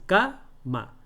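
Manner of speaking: "cama" split ca, pause, ma; syllable by syllable. Shown as a demonstration. Teacher showing how it separates, split "cama". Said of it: "Se separa así".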